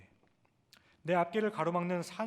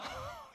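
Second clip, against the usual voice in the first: laugh; 6 dB quieter than usual. Real, not acted. Laugh after baby imitation.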